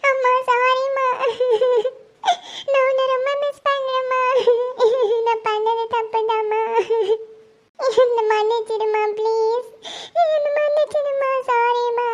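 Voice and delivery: high, slow sobbing plea; crying hard while apologizing; tearful apology. Acted. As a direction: beg forgiveness through sobs, drawing out the words. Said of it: "ஐஷுமா, அழாதம்மா".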